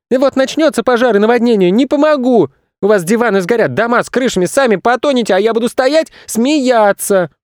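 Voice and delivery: put-on high-pitched gloating child's rant; boy shouting. A narrator's reading. Boy threatening the zoo visitors, shouting. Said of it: "крикнул Федя".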